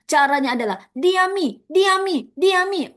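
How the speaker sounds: high, loud repeated diami; mock petty leader, diami pushed high; scheming leader. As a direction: hiss the plan diami diami.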